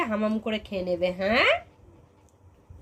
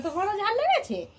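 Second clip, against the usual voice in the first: prompting line for the doll; 8 semitones higher than usual. Shown as a demonstration. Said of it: "ওকে জিজ্ঞাসা করো".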